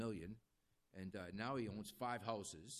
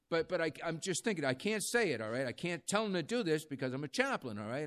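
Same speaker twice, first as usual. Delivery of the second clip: louder, pressing the point hard; emphatic; 5 semitones higher, 9 dB louder than usual. Real, not acted. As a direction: push it out loud and earnest. Chaplain agonizing aloud over lying.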